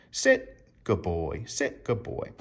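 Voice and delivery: slow, soft praise to a dog; gently reward the sit. Shown as a demonstration. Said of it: "this is why I'm getting petted".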